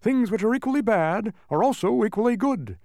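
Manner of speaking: high, loud bug lecture; showy know-it-all bug; pompous humbug. A narrator's reading. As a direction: explain it grandly, high.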